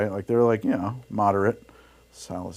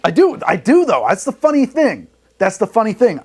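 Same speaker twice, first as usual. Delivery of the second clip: laughing excitedly; 10 semitones higher, 8 dB louder than usual. Real, not acted. Laughing admission to chat.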